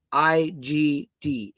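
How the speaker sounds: I G D said letter by letter, very slowly; slow letter-by-letter spelling; spelled out slowly. Shown as a demonstration. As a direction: spell I G D out with long gaps. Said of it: "text the word".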